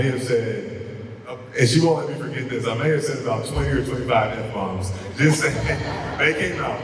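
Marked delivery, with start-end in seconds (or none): 5.2-6.8 laughing. Real, not acted. Laughing about swearing.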